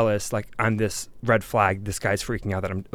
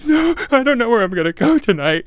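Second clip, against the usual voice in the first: high, loud, tearful wail; hammed-up sob story; mock crying; 14 semitones higher, 8 dB louder than usual. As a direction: act out the breakdown, half laughing. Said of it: "I start crying".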